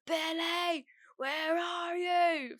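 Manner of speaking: high, long-held holler; loud drawn-out call. Retold or imitated. Ex banging at the front door, loud drawn-out yell. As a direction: imitate someone bellowing a name from outside, vowels stretched. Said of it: "I heard very loudly".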